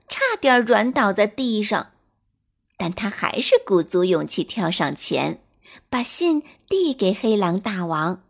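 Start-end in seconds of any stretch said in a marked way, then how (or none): none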